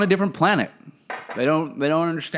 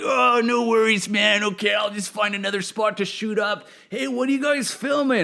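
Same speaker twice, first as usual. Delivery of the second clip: lightly voiced, higher, easygoing junkie; a friendly stoned guy on the street, unbothered; laid-back junkie; 6 semitones higher than usual.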